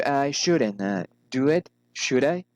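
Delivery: "it" falls, "should I" rises; falling then rising. Shown as a demonstration. Teacher models tag questions, falling then rising on "should I". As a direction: drop on "it", lift "should I". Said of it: "falling or rising intonation".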